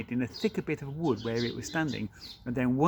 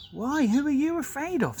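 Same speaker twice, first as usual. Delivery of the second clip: put-on young boy voice; 12 semitones higher than usual. Child asks the beaver.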